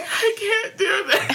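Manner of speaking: high, slow, laugh-cry whimper; a hammed-up overwhelmed sob; mock crying. Telling her co-host about crying at the film, high laughing whimper. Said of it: "And I'm just like".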